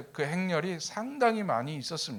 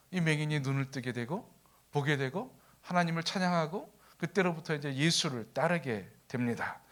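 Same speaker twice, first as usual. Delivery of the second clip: ordinary talk, nothing marked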